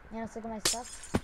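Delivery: normal talking voice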